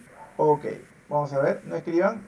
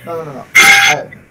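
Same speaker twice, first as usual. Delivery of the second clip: normal talking voice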